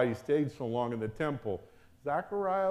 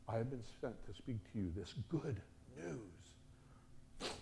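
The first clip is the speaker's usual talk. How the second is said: much softer, deliberate; hushed solemn angel; 10 dB quieter than usual. Retold or imitated. Gabriel to Zechariah, hushed and firm. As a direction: let the authority settle in quietly.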